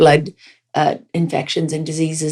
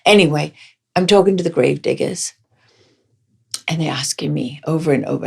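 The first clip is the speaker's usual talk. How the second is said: ordinary talk, nothing marked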